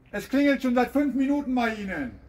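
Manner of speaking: ordinary delivery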